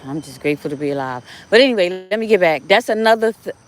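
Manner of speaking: ordinary delivery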